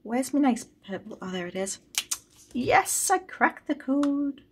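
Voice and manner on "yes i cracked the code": much higher "Yes", slow delighted "I cracked the code"; excited. Real, not acted. Saver celebrating another win to viewers, high slow cheer.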